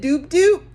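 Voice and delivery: loud, high, laughing "doop doop"; mock car-lock beep. Storyteller repeating her fake lock chirp, laughing. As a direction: chirp it again through a laugh. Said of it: "I said".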